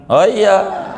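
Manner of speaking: a real laugh breaking into the words; laughing out oh iya. Real, not acted. Laughing aside.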